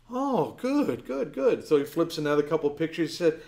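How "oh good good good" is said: high-pitched parental praise; praising father. Retold or imitated. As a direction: coo approval at a little child. Father praising his small daughter.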